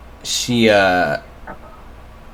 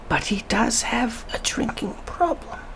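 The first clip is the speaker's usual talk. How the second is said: put-on confiding old woman's whisper, higher; 11 semitones higher, 12 dB quieter than usual. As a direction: lean in and whisper the secret. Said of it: "she leans in towards you".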